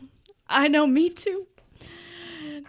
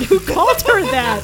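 incredulous; 3 semitones higher, 9 dB louder than usual. Real, not acted. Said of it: "Oh, dear God".

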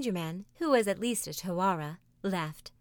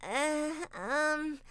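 uncomfortable Taffy; 8 semitones higher than usual. A narrator's reading. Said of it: "did not feel quite comfy".

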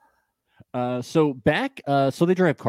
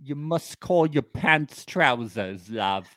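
hammed-up British rule list; mock British accent; slower than usual. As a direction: play a fussy Brit giving rules.

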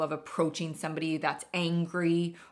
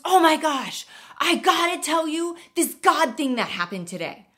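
higher and louder, gushing; excited teen; 10 semitones higher, 10 dB louder than usual. Retold or imitated. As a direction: burst out with it like a thrilled kid. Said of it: "excitedly wants to tell you".

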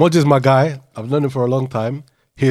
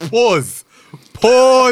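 loud pause call; 9 semitones higher, 7 dB louder than usual. Real, not acted. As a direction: shout pause, rising louder. Calling out a dodgy phrase.

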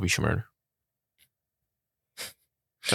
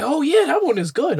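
high-pitched, a little louder, eager approval; mock eager guy; 4 dB louder than usual. Friend mocking a stranger's eager approval. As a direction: imitate him happily vouching for it. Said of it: "he was like".

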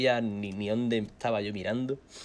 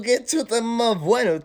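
drugged voice; 13 semitones higher, 9 dB louder, much slower than usual. Retold or imitated. Quotes himself high on painkillers.

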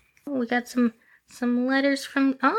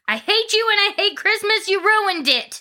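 all caps; 7 semitones higher than usual. Retold or imitated. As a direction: read it like an angry kid yelling in all caps. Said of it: "All caps".

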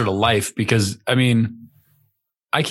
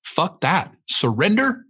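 macho scorn; 4 semitones higher than usual. Retold or imitated. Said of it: "the word surrender to me sounds like".

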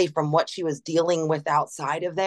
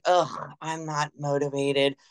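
unmotivated groan; 3 semitones lower, slower than usual. Retold or imitated. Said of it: "we have days where I'm like".

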